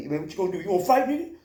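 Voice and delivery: imitating the guy's cocky dare; taunting challenge. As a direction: goad him into fighting. Other guy squaring up.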